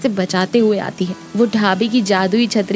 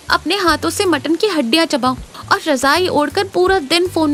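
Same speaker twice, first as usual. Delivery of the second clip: put-on higher-pitched, girlish outpouring; young schoolteacher confessing; 4 semitones higher than usual. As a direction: pour out a secret wish to an uncle.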